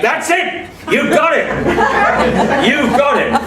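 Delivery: high, laughing shout of agreement; cheering that someone got it right; excited. Real, not acted. Delighted, praising the listener's point with a laughing high shout.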